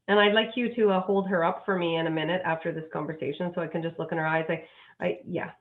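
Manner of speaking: ordinary delivery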